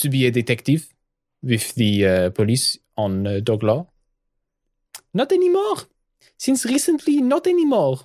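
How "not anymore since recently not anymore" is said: much higher, sheepish afterthought; rueful correction. Acted. Webby admitting she is no longer a detective, embarrassed. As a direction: correct yourself with a squeak.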